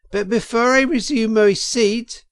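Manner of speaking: high, loud after-dinner weasel; a pompous weasel addressing the banquet; weasel speaker. A narrator's reading. Weasel toasting the crowd at the banquet, high and loud. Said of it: "a voice could be made out saying".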